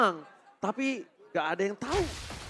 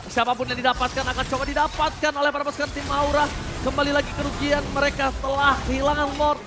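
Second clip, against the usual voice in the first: louder, punchy esports casting; fighting game announcer; 2 semitones higher, 4 dB louder than usual. Acted. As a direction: hype up every line for the crowd. S0 casting the match.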